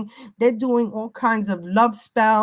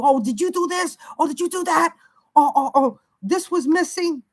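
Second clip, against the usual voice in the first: higher, accusing mock yell; 6 semitones higher than usual. Mother imitating her daughter's accusing yell, higher. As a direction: imitate the daughter nagging, pitched up. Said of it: "they're yelling at you".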